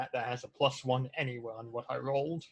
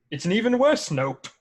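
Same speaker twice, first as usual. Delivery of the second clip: exasperated outburst; 8 semitones higher, 11 dB louder than usual. Real, not acted. Failed roll, exasperated outburst.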